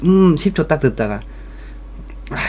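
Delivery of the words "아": ordinary talk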